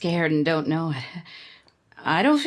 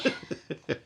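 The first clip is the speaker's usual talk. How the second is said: short laugh; 4 semitones lower, 7 dB quieter than usual. Acted. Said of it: "What's the joke?".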